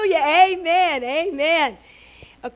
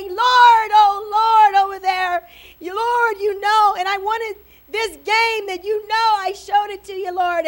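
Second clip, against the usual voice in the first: put-on screamed child's prayer; 5 semitones higher than usual. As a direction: holler a wish list to God. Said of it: "he started screaming".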